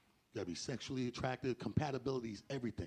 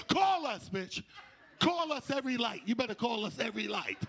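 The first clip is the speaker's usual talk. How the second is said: put-on high insistent girlfriend; 7 semitones higher than usual. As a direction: make her promise, pitched up. Friends demand she calls.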